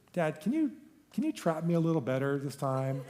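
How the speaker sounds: put-on high little boy asking, laughing; lightly voiced kid asking Dad; child's voice. Son's request voiced in story.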